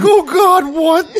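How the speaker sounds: high, loud panicked imitation; mock alarm, squeaking upward; voice cracking. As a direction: crack the voice like a startled dad. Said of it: "You can hear his voice crack".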